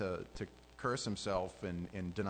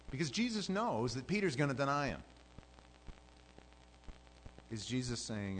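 ordinary talk

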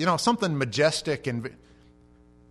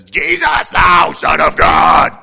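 huge, high, drawn-out demonic shout; possessed man's scream; 4 semitones higher, 18 dB louder, much slower than usual. Acted. Possessed man rushing out at Jesus, screaming full force. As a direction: explode into a slow, full-force shriek. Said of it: "this is a possessed guy".

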